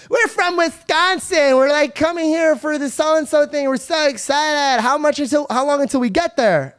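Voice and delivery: put-on bubbly tourist girl. Wisconsin girls telling their driver about the trip, high and bubbly. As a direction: gush like excited out-of-towners.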